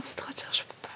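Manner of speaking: tearful whisper, voice failing; barely getting the words out through emotion; choked-up whisper. Real, not acted. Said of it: "Il y a trop d'émotions". (murmure étranglé par l'émotion)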